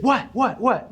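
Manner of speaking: put-on high, eager child cutting in; squeaky thrilled little boy; excited kid. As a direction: mimic an eight-year-old going wild with interest. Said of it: "and he said".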